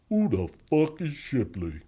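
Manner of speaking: slow, drawn-out imitation of an intimidating big man; huge inmate impression. Big looking for Shipley in cell, imitated slow menace. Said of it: "And he walks in, he goes".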